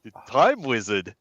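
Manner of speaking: put-on dumb, thick Brooklyn accent. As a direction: say it like a dopey Brooklyn guy. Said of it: "the dumbest Brooklyn accent you can muster".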